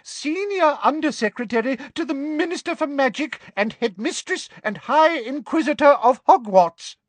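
very high, loud, frightened voice; terrified official, pitched right up. A narrator's reading. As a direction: announce yourself in shrill terror. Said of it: "in a high-pitched, terrified voice".

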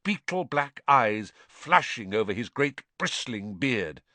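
ordinary delivery, nothing marked

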